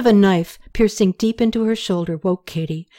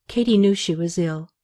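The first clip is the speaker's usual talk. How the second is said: ordinary delivery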